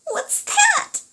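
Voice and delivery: very high, loud squeak; a startled tiny mouse squeaking. Acted. Henry hearing a howl behind them, frightened squeak. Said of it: "Henry squeaked".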